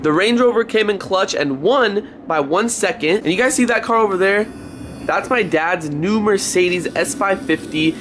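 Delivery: ordinary delivery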